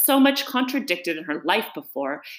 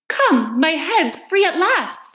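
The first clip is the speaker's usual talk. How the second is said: high, louder, slow cry of relief; delighted; 10 semitones higher, 6 dB louder, slower than usual. Acted. Alice finds her head free at last, delighted. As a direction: exclaim with delight at being freed. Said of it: "in a tone of delight".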